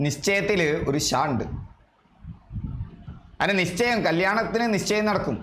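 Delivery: ordinary talk, nothing marked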